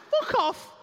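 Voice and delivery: loud, very high exclamation; outraged dismissal. Retold or imitated. Comedian hears his foursome is fake, loud high outrage. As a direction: squeal it in outraged disbelief. Rejects mate's claim it's fake.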